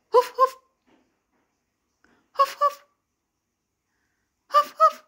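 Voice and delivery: loud, high, mock huffing sounds; playful huffing. Real, not acted. (malakas na pabirong paghingal)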